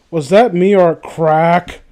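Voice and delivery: usual speaking voice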